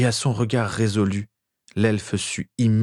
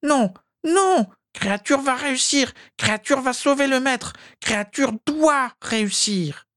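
high elf voice. Acted. Elf insisting he will save master, high elf voice. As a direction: insist like a desperate loyal elf.

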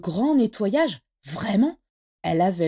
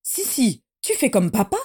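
exclaimed; 2 semitones higher, 4 dB louder than usual. A narrator's reading. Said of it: "s'exclama-t-elle".

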